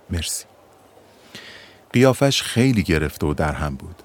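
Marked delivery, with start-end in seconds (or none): 0.1-0.5 a soft, barely voiced murmur; under his breath. Boy to narrator, muttered thanks under breath. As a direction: mumble thanks with head down. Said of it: "زیر لب گفت".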